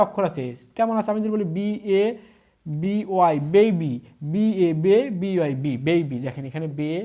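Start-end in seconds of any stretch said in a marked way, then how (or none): 4.3-5.7 letters first, then each syllable বে, বি; syllable-by-syllable demo. Shown as a demonstration. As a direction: spell and sound বে, then বি. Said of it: "এখানে কয়টা অক্ষর আছে".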